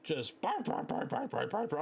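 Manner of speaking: yappy woofs tossed out high and quick; imitated dog barking. Retelling a gaming night, he imitates a friend's dog barking. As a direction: bark like the little dog on the mic. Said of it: "Kitty's dog just starts barking".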